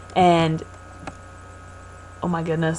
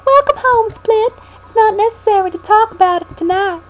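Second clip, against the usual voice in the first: Southern belle; 6 dB louder than usual. Acted. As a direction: play a doting Southern belle mother. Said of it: "talking like a Southern belle".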